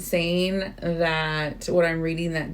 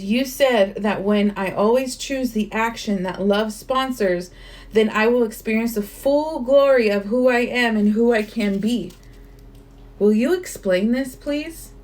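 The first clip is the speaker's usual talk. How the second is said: louder, measured reading of a question; earnest book reading; 4 semitones higher, 4 dB louder than usual. Acted. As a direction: read the question sincerely. Reads Neil's question from the book.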